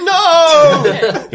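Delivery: high, loud cry of refusal; frantic high shout, acted; panicked guard. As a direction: yell it while fleeing in panic. Guard panics while running away.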